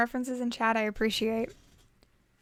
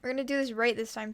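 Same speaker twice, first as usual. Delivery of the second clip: ordinary delivery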